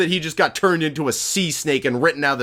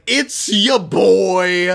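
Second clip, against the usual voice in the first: smug reveal; 4 semitones higher, 4 dB louder, much slower than usual. Acted.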